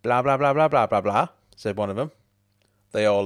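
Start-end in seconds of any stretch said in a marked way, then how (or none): 0.0-1.3 loud, higher sing-song mockery; mocking blah blah. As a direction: jeer it like a pub mate teasing. Mates mocking in the pub.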